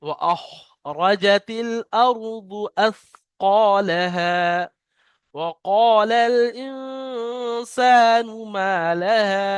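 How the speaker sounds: slow, drawn-out tajweed chant; Quran recitation. Shown as a demonstration. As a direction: recite each verse slowly and melodically. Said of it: "তিনটে আয়াত আমি তেলাওয়াত করলাম". (ধীর সুরে কুরআন তেলাওয়াত)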